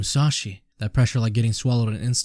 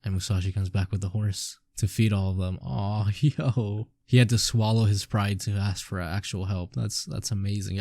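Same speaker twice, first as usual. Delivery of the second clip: ordinary talk, nothing marked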